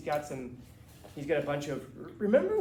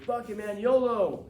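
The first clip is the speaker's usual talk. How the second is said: higher, louder careless yolo; mock reckless; 6 semitones higher, 8 dB louder, slower than usual. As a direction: ham up a careless daredevil. Mocking a reckless attitude.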